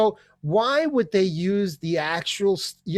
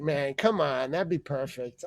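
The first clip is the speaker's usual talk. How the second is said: ordinary talk, nothing marked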